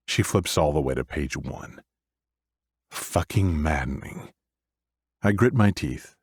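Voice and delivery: ordinary delivery, nothing marked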